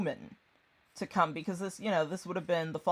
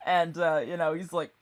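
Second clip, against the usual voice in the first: ordinary delivery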